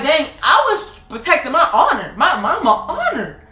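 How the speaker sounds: put-on high, laughing child's protest; high laughing cry of betrayal; laughing mock outrage. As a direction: play your younger self, betrayed and amused. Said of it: "I was like".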